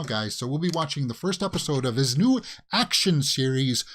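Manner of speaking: normal talking voice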